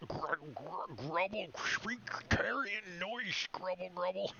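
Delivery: whispered, rasping monster gibberish; creature gibberish. Acted. Creature answering the player in common, whispered monster noises. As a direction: growl and hiss nonsense like a beast. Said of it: "he says".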